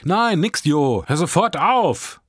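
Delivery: loud, slow, high-pitched order; shouted back. A narrator's reading. Teen at window orders boy to stop, shouting slowly. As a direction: yell each word slowly and emphatically. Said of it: "schrie ich zurück".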